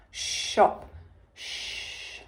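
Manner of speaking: "shop" whispered, then a long hushed "Shh"; whispered sounds. Shown as a demonstration. Teacher to pupils, sounding the sh digraph in a whisper. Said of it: "using one of our new digraphs".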